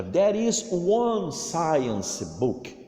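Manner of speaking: slow, careful English sentence for repeating; teacher modelling a sentence slowly; slow model reading. Shown as a demonstration. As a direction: read it out slowly so pupils copy. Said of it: "Olha a pronúncia".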